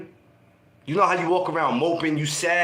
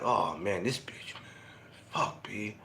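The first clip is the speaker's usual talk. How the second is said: low, quiet grumble under the breath; muttered frustration; 10 semitones lower, 11 dB quieter, slower than usual. Retold or imitated. Heartbroken teen mutters on seeing his ex at the park, low and quiet. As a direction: mumble it to yourself, fed up.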